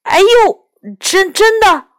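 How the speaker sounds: high, loud yelp of pain then stammer; ouch, stammering high and loud. Acted. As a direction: cry out as your arm is pinched. Arm being pinched by friend.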